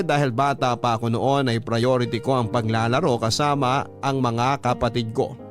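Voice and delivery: ordinary talk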